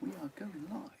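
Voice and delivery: hushed whisper going live. Real, not acted. Streamer whispering to viewers as he goes live, hushed whisper.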